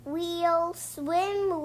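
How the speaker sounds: ordinary delivery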